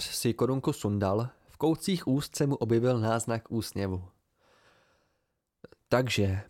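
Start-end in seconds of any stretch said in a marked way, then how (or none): none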